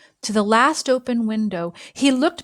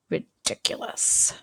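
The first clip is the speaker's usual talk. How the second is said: whisper; 7 dB quieter than usual. A narrator's reading. Pete's unspoken word, whispered.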